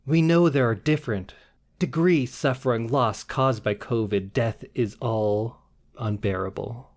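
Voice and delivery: slow, dramatic beat poet reading; beat poetry. Shown as a demonstration. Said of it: "I'll try to read this one like a beat poet".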